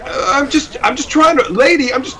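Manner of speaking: high, stammering, timid retold reply; flustered. Yo-yo player retells stammering back at the screaming lady, high and flustered. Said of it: "And I'm like".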